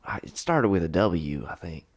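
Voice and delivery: put-on thick Southern accent. Prisoner to detective, sudden thick Southern accent. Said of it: "you just got an accent really thick".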